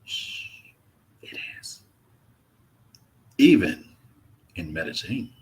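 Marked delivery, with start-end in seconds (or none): 0.1-1.8 hushed, breathy aside; mock secret whisper. To chat, hushing them about Medellin women, whispered.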